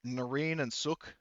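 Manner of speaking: usual speaking voice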